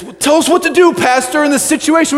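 hammed-up demand for the pastor's answer; mock congregation plea. Congregation pleading loudly to pastor.

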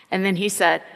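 ordinary delivery

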